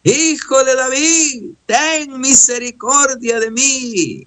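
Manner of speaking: high, long-held imploring call; crying out for mercy. Acted. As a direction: keep calling out, stretching each phrase. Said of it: "Y vuelve".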